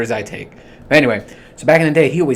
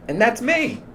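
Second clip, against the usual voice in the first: squeaky high voice; 11 semitones higher than usual. Shown as a demonstration. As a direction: jump up high for a mock-innocent that's me. Contrasting himself with the boss.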